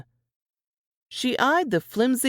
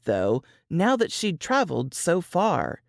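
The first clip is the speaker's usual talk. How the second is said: normal talking voice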